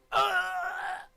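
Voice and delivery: loud, high playful shriek; co-host shrieking at the game story; high mock shriek. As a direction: let out a high mock scream. Reacting to the hum story.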